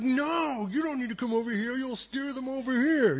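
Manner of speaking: high, fast mock boys' voice; high mock protest from the boys; panicky boys. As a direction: squeal it like scared teenage boys. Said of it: "the boys are like".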